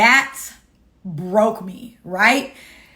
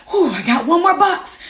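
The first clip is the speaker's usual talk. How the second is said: higher, louder self pep talk, retold; hyping herself up; 7 semitones higher, 4 dB louder than usual. Mother packing alone hypes herself up, excited. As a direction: pump yourself up for the last box. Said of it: "I'm, like, hyping myself up".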